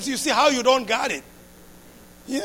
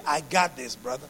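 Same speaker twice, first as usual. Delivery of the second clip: lightly mimicked casual American brag, low and easy; a hint of laid-back American bravado; American swagger; 10 semitones lower, 7 dB quieter than usual. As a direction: toss it off like a cocky American guy. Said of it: "that's what the Americans say".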